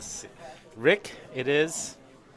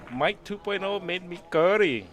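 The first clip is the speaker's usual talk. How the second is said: excited, higher and louder mock Indian accent; 5 semitones higher, 3 dB louder than usual. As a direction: exclaim in a hammed-up Indian accent. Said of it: "I just did a Vietnamese and a Indian accent".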